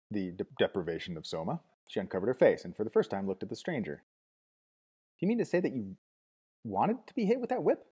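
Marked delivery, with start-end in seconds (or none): 4.0-7.8 high, slow, disbelieving; can't believe what she hears; breathless girlish disbelief; astonished. A narrator's reading. Lenina astonished, voiced high.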